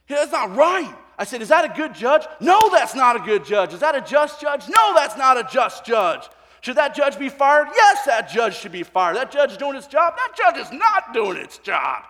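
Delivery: loud, high call and response; a preacher rousing the congregation; preaching at full force. Acted. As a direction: hammer each question home to the crowd.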